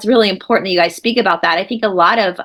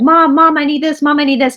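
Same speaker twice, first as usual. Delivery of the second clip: high, louder mock kids calling Mom; nagging kids; 5 semitones higher, 3 dB louder than usual. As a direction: imitate children pestering their mom. Kids nagging mother, retold.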